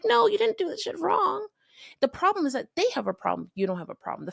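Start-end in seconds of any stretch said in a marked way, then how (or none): 0.0-1.5 emphatic correction. Pretend. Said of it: "it's like".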